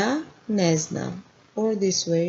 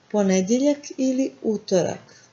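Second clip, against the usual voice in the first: ordinary talk, nothing marked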